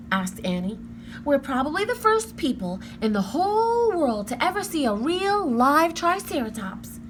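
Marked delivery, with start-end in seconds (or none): 1.2-7.0 lightly voiced eager little girl, higher; a hint of a thrilled young girl; coaxing her brother, a little girl eager to see. A narrator's reading. Annie's voice in the story.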